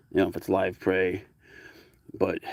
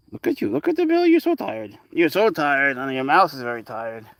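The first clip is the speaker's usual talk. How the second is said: cooing at pet; 4 semitones higher than usual. Real, not acted. Owner coos at her tired cat, very high baby talk. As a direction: coo up high at the sleepy kitty.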